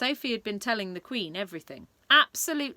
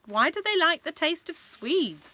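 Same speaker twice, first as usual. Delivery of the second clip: puzzled King; 9 semitones higher, 5 dB louder than usual. Acted. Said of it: "the King asked".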